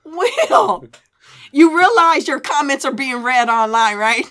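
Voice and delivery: loud, high calling-out; amused scolding. Real, not acted. Wife warning husband his comments are read online, loud scolding. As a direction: call him out loudly, scandalised.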